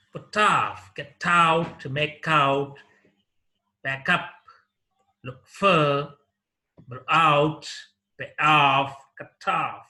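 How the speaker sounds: each pair like "put off", "look for" stressed on the second word; stress on particle. Shown as a demonstration. Teacher listing phrasal verbs like "go out", the particle stressed in each. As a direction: punch OFF, OUT, UP, FOR in "put off", "get out", "back up", "look for". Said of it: "The stress is used on the second part".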